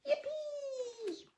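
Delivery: high, squealing yippee; cheer. Acted. Storyteller cheering along with the monsters, gleeful. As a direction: squeal it with glee.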